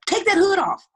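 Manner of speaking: high, loud mock yell; retold urgent order. Friend ordering her son off to school, imitated urgent yell.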